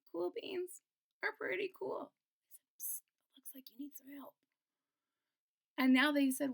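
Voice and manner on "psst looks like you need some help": fast unvoiced whisper. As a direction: whisper it quickly. Cool beans whisper offer.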